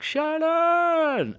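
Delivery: high shout from the garden; dad yelling across the garden; calling out a name. Retold or imitated. Dad calls his daughter from the garden, high shout. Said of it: "I got this shout from".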